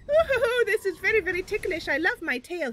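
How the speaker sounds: giddy creature enjoying his tail; delighted Mister Buns. Acted. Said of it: "Mister Buns is like".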